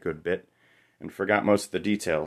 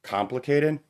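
Complicated? thrown back higher, rising in disbelief; groaning disbelief; 7 semitones higher than usual. Acted. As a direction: echo Complicated? mockingly, pitch jumping up. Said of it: "I groaned at her".

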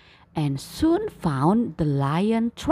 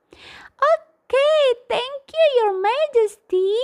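much higher tiny grateful squeak; squeaky mouse; 5 dB louder than usual. Acted. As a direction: squeak thanks to the king. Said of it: "squeaked the mouse".

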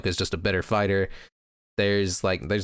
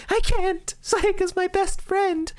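put-on much higher, slow, girlish protest; pleading girl voice; slower than usual. Madoka refusing to leave her best friend, high and pleading. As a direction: plead like a tearful schoolgirl.

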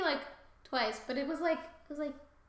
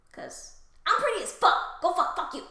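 loud, high-pitched mock outrage; hammed-up indignation; 5 semitones higher, 9 dB louder than usual. Spurned goddess telling off Paris, loudly indignant. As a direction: spit out a vain, offended rant.

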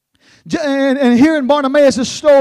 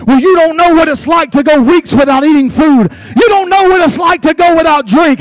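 loud, anguished, higher protest building in force; Bartimaeus crying out; 3 semitones higher, 4 dB louder than usual. Retold or imitated. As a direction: hurl it back at the crowd with a breaking cry. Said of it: "blind Bartimaeus is in the back of his head".

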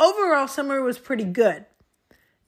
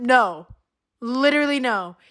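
usual speaking voice

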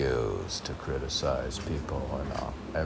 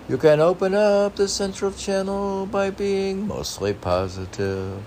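much higher, strained reading voice; put-on reading voice; 4 dB louder than usual. Shown as a demonstration. Reading a spiritual saying to his partner, lofty high voice.

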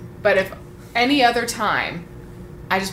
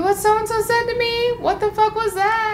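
much higher, hammed-up gossip voice; gossipy squeal. Telling a friend what so-and-so said, a mock high squeal. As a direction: squeal it like spilling juicy tea to a friend. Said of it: "Like, if it was like".

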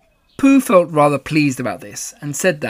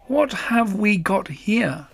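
slow, higher musing; considering voice; 6 semitones higher, much slower than usual. Acted. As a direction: ponder over the taste. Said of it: "considering noises".

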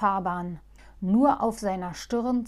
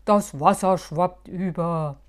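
calling out; 2 semitones lower, 8 dB louder than usual. Acted. Moosbart warning that the water spills over. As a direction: call it across the car. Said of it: "rief Moosbart".

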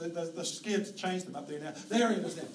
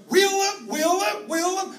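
much higher, louder repeated shout; shouted dog command; 10 semitones higher, 9 dB louder, slower than usual. Retold or imitated. Farmer shouts to dog to turn sheep, loud high shout. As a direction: bark it out to turn the flock. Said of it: "You go".

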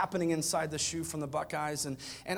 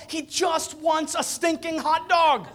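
slow, loud, higher exasperation; exasperated shout; 11 semitones higher, 7 dB louder, slower than usual. Real, not acted. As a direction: shout the punchline in frustration. Exasperated punchline to crowd.

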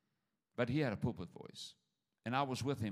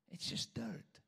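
whispered, higher and soft; hushed whisper; 8 semitones higher, 6 dB quieter, slower than usual. Shown as a demonstration. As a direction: breathe it out as a secret. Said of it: "repeated dirt line".

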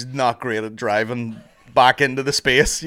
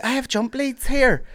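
lightly voiced girl; 11 semitones higher, slower than usual. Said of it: "some other girl was like".

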